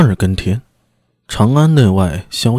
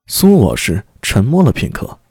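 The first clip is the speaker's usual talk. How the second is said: ordinary delivery, nothing marked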